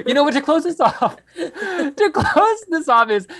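talking through real laughter; laughing. Real, not acted. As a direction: giggle while getting the words out. Laughing through closing line.

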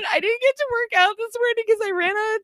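high, tearful complaint; teary whine. Retold or imitated. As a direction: whine it like you're about to cry. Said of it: "I was super emotional".

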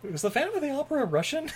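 high, soft mock disbelief; mocking the Russian Phantom, high and incredulous; mock incredulous question. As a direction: ask it in pretend bafflement. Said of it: "I'm like".

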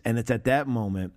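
ordinary talk

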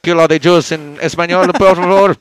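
put-on loud ranting Spanish-accented speech; mock accented Hitler. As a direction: bark it as the Mexican dictator. Continuing Mexican Hitler bit.

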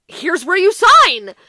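hammed-up car dealer closing the deal; eager salesman.

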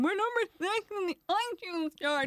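high, giddy re-enacted announcement; overexcited. Host retells tweeting the chart news, overexcited. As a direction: squeal it like you might pass out. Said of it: "nearly passed out".

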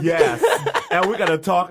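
laughing through the words; bursting out with a laugh, then carrying on. Real, not acted. Laughing along with the guest's excitement.

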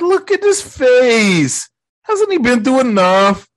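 high, drawn-out appeal; hammed-up imitation of someone begging for a friend; mock pleading. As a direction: plead his case in a high voice. Strange pleads with Wong for Peter.

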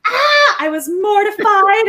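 put-on high, loud retelling of a girl's shock; mock horrified cry. A teller voicing the shocked girl, loud and high.

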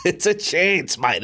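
laughing, high, slow mimic of the guest's tip; mock imitation of Smithy. Host teasing the guest about his horse tip, laughing high mimicry. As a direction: drawl it out, teasing.

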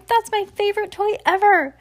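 high, whiny protest; high little-boy cry. A narrator's reading. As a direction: plead for a beloved toy. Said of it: "he cried".

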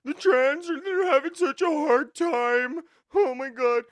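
high sarcastic mock pleading. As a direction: sneer it out in a high put-on voice.

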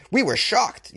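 high-pitched mock-shocked churchgoer; exaggerated outraged astonishment. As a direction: exclaim it as a scandalized parishioner, hammed up. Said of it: "told the local outlets that they were shocked".

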